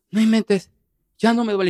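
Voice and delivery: más agudo y algo más fuerte, sorprendida de que ya no duele; asombro aliviado. Retold or imitated. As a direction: imitando a la esposa, aguda e incrédula. Esposa sorprendida tras acomodarle pie. (la esposa, sorprendida al ver su pie curado, exclama aguda)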